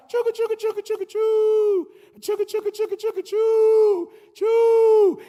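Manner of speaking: high chugga chugga choo sounds; playful high train noise with a final choo; train chugging. Retold or imitated. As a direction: puff it out like a steam train. Imitating the train engine.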